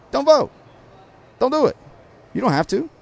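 emphatic high-pitched appeal; urgent plea. Real, not acted. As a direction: urge them, rising pitch. Urging listeners not to vote.

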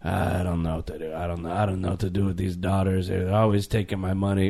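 low, soft lament; hammed-up sad grumpy father; despairing old man. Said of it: "And then he's like".

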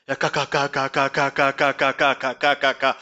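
mock stutter, low and steady; imitating a stutterer. Vlogger mocking a stuttering doctor, imitating a stutter. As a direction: stammer on ka over and over.

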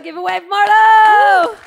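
loud, slow call across the room. Real, not acted. Host calling to her daughter's friend in the audience, loud call.

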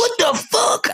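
high-pitched shocked protest; outraged kid. Retold or imitated. Kid just chopped in the throat, high outraged protest. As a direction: imitate a kid yelping in disbelief.

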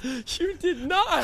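drawn out, can't believe it; stunned disbelief. Real, not acted. Friend stunned that he faked working there.

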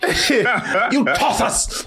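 high, drawn-out mock British insult; laughing send-up of a bad British accent; mimicked British accent. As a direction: parody a Dickensian British urchin.

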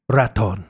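raton said the Spanish way, low and falling; raton, low falling Spanish pronunciation; Spanish word raton. Shown as a demonstration. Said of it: "I'm just gonna say the word".

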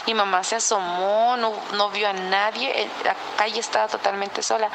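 ordinary delivery

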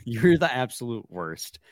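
normal talking voice

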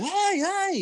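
high call of hey, hey to a passing driver. Retold or imitated. Fan at Bristol calls out to drivers, high hey. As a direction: shout hey up high across the track. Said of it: "be like".

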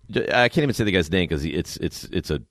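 ordinary talk, nothing marked

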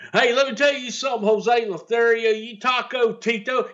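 high fast cocky taunt; heel promo. Retold or imitated. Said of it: "Buddy came up with".

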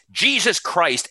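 a sudden high shout; retold scream. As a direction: blurt it out high, like the boss's outburst. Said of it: "he just screams".

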